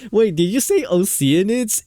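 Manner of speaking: high, loud mock-puzzled question; imitating Paimon. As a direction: put on a high, incredulous little sidekick voice. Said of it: "I was thinking Paimon's gonna be like".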